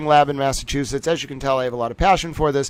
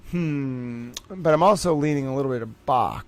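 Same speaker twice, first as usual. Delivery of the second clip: much softer, thinking aloud to herself; quiet musing; 3 semitones lower, 9 dB quieter than usual. Real, not acted. Streamer pondering the Moonlight Sonata question, quiet musing. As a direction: murmur it, weighing the answer. Musing over a quiz answer.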